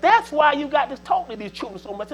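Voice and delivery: usual speaking voice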